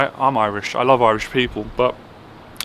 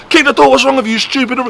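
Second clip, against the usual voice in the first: higher, imitating a sharp, aggressive boss; sharp aggressive mimic; 10 semitones higher than usual. Apprentice mimics his boss snapping orders aggressively. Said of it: "Like, sharp, aggressive".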